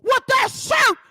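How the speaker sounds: loud, high mocking shout; mock shouting rant. Mocking a reality star loudly for her beliefs.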